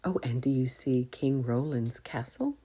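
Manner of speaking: plain reading voice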